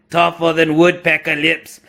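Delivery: hammed-up Aussie accent; Australian accent. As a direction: put on a cartoon Australian mate. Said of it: "my use of an Australian accent".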